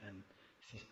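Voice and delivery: ordinary delivery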